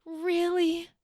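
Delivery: high, loud mock delight; hammed-up melting into forgiveness; gushing lover. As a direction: gush like a partner who just won. Acting Cassius won over.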